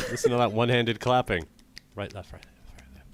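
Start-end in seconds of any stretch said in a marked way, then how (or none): 1.9-3.1 soft voiceless counting; whisper. Acted. Guiding the clapping game.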